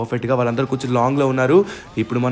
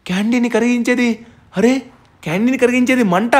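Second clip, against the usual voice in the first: high-pitched dawning realisation; excited realisation; 9 semitones higher than usual. Acted. Gamer works out that fire melts candy, excited realisation. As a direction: pitch up as the answer hits.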